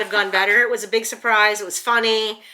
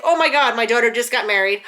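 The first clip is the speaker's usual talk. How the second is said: mom's loud, raised-pitch shock; retold excited screaming; 5 dB louder than usual. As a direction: burst out as the news dawns. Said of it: "She was screaming".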